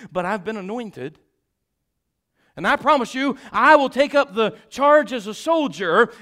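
rising from steady telling to loud, high preaching; building preaching. Acted. Builds into preaching retelling David.

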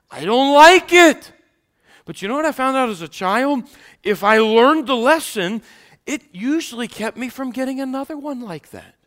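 loud, high pulpit preaching; rising loud sermon delivery; preaching at full force. Acted. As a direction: preach it out to the whole congregation.

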